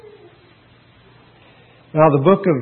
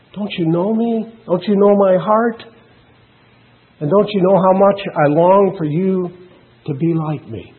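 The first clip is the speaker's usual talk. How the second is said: higher, yearning plea as a loving God; heartfelt, pleading questions; tender; 5 semitones higher than usual. Acted. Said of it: "The tender voice of God says".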